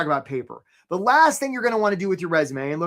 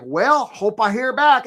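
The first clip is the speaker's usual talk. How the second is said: slow, higher drawl in a mock twang; hopeful drawl accent; 5 semitones higher, 5 dB louder, slower than usual. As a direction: drawl it out like a hopeful country job seeker. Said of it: "that accent all of a sudden".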